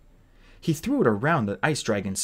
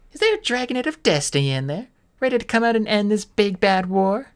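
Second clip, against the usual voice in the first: high, loud, fast mocking voice; mocking baby talk; 11 semitones higher, 5 dB louder than usual. A narrator's reading. Queen mocking the egg, high loud and fast. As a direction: coo at the egg to taunt him.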